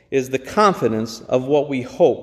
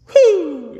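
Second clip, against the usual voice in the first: high, exhilarated exclamation; joyful whew; 12 semitones higher, 3 dB louder than usual. Real, not acted. Preacher thrilled after reading the miracle, high joyful whew. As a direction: blow it out with delight. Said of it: "Isn't that great scripture?".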